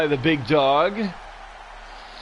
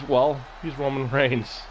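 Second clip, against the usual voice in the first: ordinary talk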